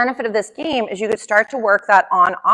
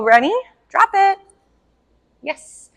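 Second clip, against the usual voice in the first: upbeat cue, then a much higher "Drop it"; dog cue demo; 10 semitones higher than usual. Shown as a demonstration. Trainer showing the owner how to cue the puppy, with a bright high "Drop it". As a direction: call "Ready?" then lift high on "Drop it". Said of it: "and you could go".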